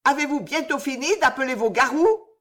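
high, loud scolding growl; high angry rebuke. Acted. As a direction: Madeleine chiding the witch. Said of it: "Madeleine gronda".

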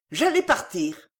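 ordinary delivery

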